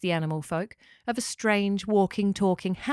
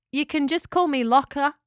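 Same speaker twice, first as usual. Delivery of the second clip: higher, louder, lightly voiced character; friendly talking house; 6 semitones higher, 5 dB louder than usual. A narrator's reading. Locker introduces herself to Spike, lightly voiced friendly house. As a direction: introduce yourself cheerily.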